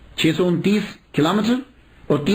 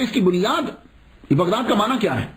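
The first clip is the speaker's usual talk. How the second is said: ordinary talk, nothing marked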